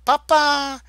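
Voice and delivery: high, loud wail. Acted. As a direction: cry out for papa. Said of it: "she wailed".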